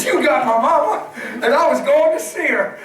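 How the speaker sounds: high, loud, laughing disbelief; giddy laughing recall. Retold or imitated. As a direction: laugh it out in joyful wonder. Laughing at her memory.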